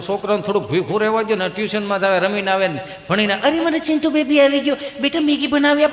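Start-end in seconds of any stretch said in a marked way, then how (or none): 3.3-5.9 higher-pitched fussing mother; mock modern mum offering treats; doting mummy. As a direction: coo at a child in a raised voice. Acts doting mother to child.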